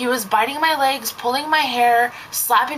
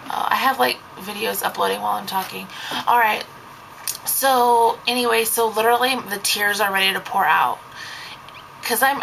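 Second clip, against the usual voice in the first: ordinary talk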